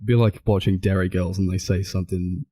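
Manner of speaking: ordinary delivery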